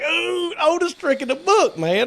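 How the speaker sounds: high, loud teasing squeal; mocking tease. Teasing Trey on the phone, high mocking squeal. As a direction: rib a buddy for falling for it.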